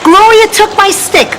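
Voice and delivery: high-pitched mock yell of a child's complaint; high, insistent imitated yell. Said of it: "I can still hear her yelling".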